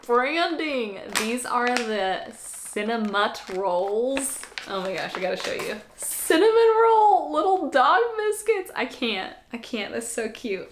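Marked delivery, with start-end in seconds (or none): 6.0-8.7 high, loud excited gush. Real, not acted. Showing dog treats to viewers, loud high gush. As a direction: show them off with a squeal.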